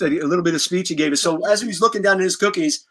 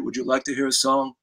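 Gene's offer; 5 semitones lower, 6 dB quieter than usual. Retold or imitated. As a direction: offer it low like a generous idol. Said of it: "he gave in and said".

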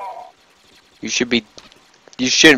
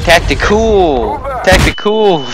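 high, slow, drawn-out shout of Tactical; mock call-out; 7 semitones higher, 3 dB louder, slower than usual. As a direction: bellow Tactical like a battle cry.

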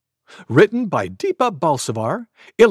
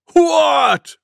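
high, loud bellow; 13 semitones higher, 7 dB louder than usual. Acted. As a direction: bellow the word out. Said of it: "bellowed Wild Buffalo".

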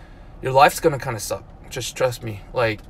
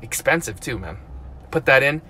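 ordinary talk

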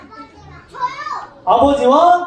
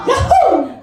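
high-pitched whoop of glee; elated cheer; 14 semitones higher, 3 dB louder, much slower than usual. Acted. Younger son just given money, elated cheer. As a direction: whoop like you just got rich.

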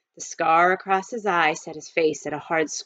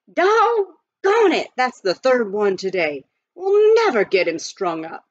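throwing down his hat in frustration; exasperated justice; 5 semitones higher, 5 dB louder than usual. Acted. Justice of the peace fumes at the delay, exasperated.

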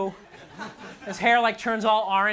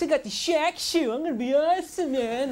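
a slightly higher, lightly voiced cocky kid; mock sneaker buyer; 5 semitones higher than usual. Comic imitates a buyer of the Shaq shoe, mild cocky voice. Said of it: "Spend, like, a hundred and fifty bucks".

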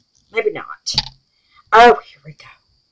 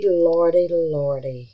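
ordinary delivery

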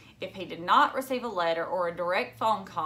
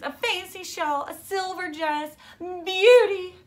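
much higher, swept up in the wonder; dreamy delight; 11 semitones higher, 6 dB louder than usual. Acted. Phoebe getting carried away in her class report. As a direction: savour each gift breathlessly.

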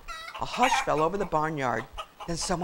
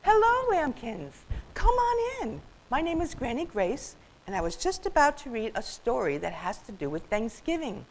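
welcoming granny storyteller; 7 semitones higher than usual. Acted. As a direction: greet the children in a high granny voice.

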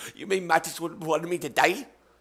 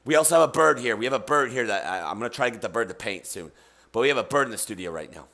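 low, soft, quick mock ASMR murmur; a hammed-up ASMR read, low and soft; 7 semitones lower, 4 dB quieter than usual. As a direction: pretend to soothe like an ASMR host. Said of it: "This is an A S M R experience".

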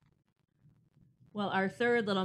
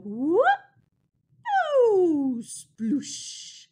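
loud whoop then splashy sploosh; silly splash sound; 7 semitones higher, 10 dB louder, much slower than usual. Shown as a demonstration. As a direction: shout it like jumping into paint. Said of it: "we're gonna make a silly sound".

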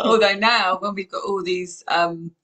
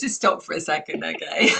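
ordinary delivery

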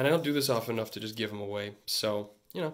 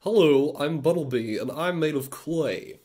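put-on cartoon creature voice, hard first hello; hammed-up character voice; Bunnelby. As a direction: voice a little clay critter introducing himself. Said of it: "looks like he would talk like this".

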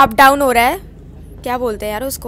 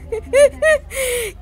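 high giggle; 10 semitones higher than usual. Real, not acted. Mum laughing during train makeup.

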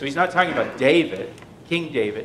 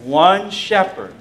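one shepherd, higher, louder and slow; stressed phrase; 4 semitones higher, 6 dB louder, slower than usual. Shown as a demonstration. Preacher pointing the congregation to one shepherd, stressed slowly.

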